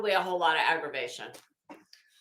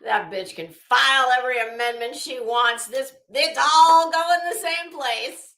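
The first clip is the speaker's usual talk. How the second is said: loud, scornful rant; angry outburst; 3 semitones higher, 8 dB louder than usual. Real, not acted. Streamer venting at a rival, loud and scornful. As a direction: spit it out in disgust.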